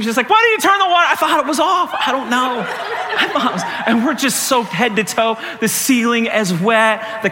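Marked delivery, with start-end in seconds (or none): 0.3-1.1 high, loud mock-angry demand; angry daughter. Daughter blaming dad for the soaking, mock angry yell. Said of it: "she's so angry". 1.1-3.6 laughing helpless excuse. Retold or imitated. Dad's defence to angry daughter.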